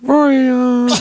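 lightly put-on woman voice; man doing a woman's voice. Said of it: "why is your woman voice the same".

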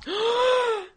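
sharp high gasp of shock. Real, not acted. Reacting to the guy's rudeness, a high shocked gasp. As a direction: gasp in mock horror. Reaction to rude seat-taker.

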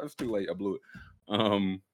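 ordinary talk

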